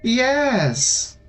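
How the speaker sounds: high pleading doll voice. Acted. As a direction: beg like a lonely child doll. Doll answers GM.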